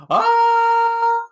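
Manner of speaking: high, loud cartoon scream of a priest; hammed-up terrified priest scream; mock scream. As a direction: shriek as a spear falls on you. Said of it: "The priest looks up and goes".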